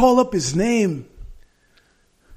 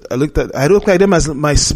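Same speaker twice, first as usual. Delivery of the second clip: ordinary delivery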